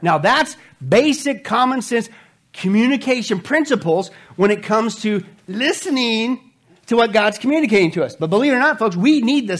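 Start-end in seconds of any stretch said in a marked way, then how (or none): none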